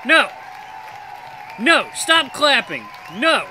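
high, loud outburst; protesting shout. Real, not acted. Gamer telling the crowd to stop clapping, shouting. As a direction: shout down the applause.

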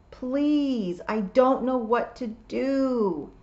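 high, drawn-out plaintive plea; wailing. A narrator's reading. Tiffany begging William for help, wailing. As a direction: wail it helplessly, slowing down. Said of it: "Tiffany wailed".

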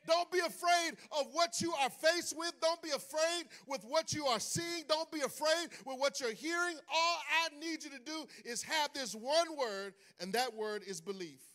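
high, fervent preaching. Acted. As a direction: lift it up over the church. Preaching Jesus' words.

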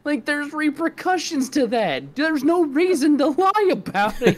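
very high, loud, laughing protest; incredulous rant. Real, not acted. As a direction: argue in squealing disbelief. Heated rant about the store lying.

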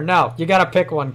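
ordinary talk, nothing marked

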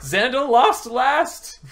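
high, slow, drawn-out gloat; shrieking jackpot winner. Real, not acted. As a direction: squeal it in triumph. Gloating over the game's outcome.

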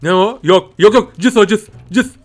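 put-on high, loud no no to a baby; loud warning to baby. Father warns baby off the battery, loud high warning. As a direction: scold a toddler away from danger.

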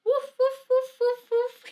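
string of high, playful woofs; dog barking. Acted. Teacher answering what the dog says, high playful barking. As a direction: answer with cute high puppy barks. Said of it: "el perro hace uf".

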